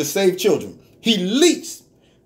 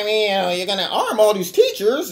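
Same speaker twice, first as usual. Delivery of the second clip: imitating a whiny pundit; lightly mocking whine. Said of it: "he got the whiny voice".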